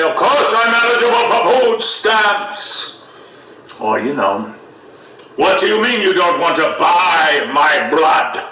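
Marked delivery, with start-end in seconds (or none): 0.0-3.0 high, slow theatrical declaiming; projected stage voice. Acted. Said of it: "you learn to project your voice". 5.4-8.4 high-pitched theatrical indignation; projected stage voice. Acted. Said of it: "you learn to project your voice".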